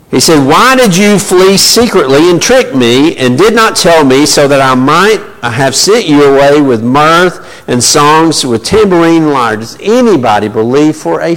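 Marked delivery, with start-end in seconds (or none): 0.5-9.6 blustering Laban. Acted. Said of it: "But he is on a bluster right now".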